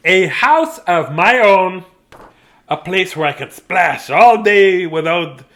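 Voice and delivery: loud, high, slow and savoured; relishing delight. A narrator's reading. Dudley delighting in his own house, slow and loud.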